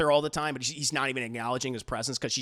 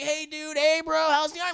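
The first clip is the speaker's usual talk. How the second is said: high dumb bro greeting, mocking; 12 semitones higher than usual. Ranting about the anime, he mocks Kyosuke's friends: high, sneering bro voice.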